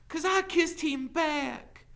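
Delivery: high, loud, stretched-out cry; defiant confession. A narrator's reading. Teacher owning up to the sheriff, defiant. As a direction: declare it boldly.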